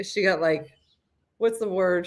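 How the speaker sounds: ordinary talk, nothing marked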